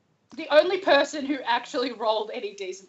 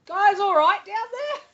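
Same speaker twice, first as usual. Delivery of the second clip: high, raised call downstairs; 10 semitones higher than usual. Acted. [name] to the others below, calling down. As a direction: call down to check on everyone. Calls from top of stairs.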